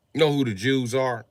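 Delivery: usual speaking voice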